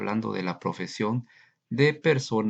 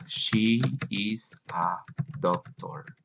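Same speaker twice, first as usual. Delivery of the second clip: muy lento y claro; slow model reading; much slower than usual. Shown as a demonstration. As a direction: dictado lento para la clase. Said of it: "modelo lento para alumnos". (lectura modelo muy lenta)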